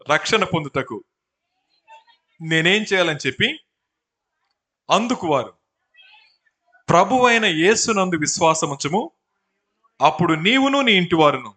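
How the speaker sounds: loud, measured proclamation; scripture reading. A narrator's reading. Preacher reading the apostles' answer on salvation, proclaimed. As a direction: declaim it in separated phrases.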